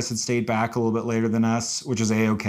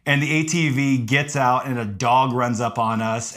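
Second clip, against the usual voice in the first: ordinary talk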